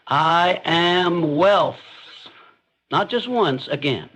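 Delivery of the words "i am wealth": very slow, drawn-out command; commanding affirmation. Shown as a demonstration. Speaker showing listeners how to affirm wealth, slow and commanding. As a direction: declare it as an order, stretching every word. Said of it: "Say it like a command".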